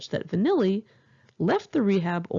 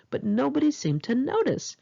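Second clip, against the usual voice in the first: plain reading voice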